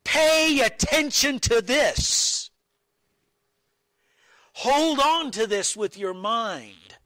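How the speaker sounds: high, loud and slow, every word pressed; urgent command. Pretend. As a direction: urge the disciples to hold on. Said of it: "Jesus is saying".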